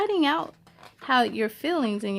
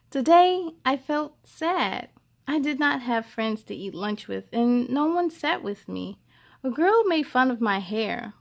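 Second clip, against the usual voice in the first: put-on louder, lively storybook reading for kids; reading to children; 3 semitones lower, 6 dB louder than usual. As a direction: read Esperanza's words out to children. Reads the book aloud.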